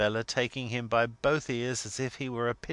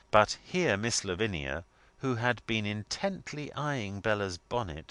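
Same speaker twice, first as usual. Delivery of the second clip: ordinary delivery, nothing marked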